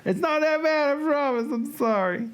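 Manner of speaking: high, squeaky apology; mock pleading. Begging a departed viewer to stay, mock pleading.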